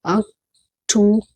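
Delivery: अक्टू- slowly split, "ok... too"; syllable-by-syllable pronunciation. Shown as a demonstration. Teacher breaking October down, syllable-by-syllable pronunciation. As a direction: stretch out each piece of अक्टू-. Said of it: "अक्टूबर नहीं है क्या".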